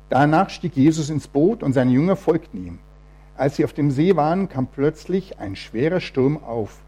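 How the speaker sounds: measured Bible reading to the congregation; scripture reading. Acted. Preacher reading Matthew to the church, measured and solemn. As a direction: read the gospel story out with weight. Reads Bible passage aloud.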